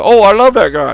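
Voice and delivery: high, loud sarcastic gush; mock gushing fan. Mocking Ryback praising Logan Paul. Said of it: "Ryback will say".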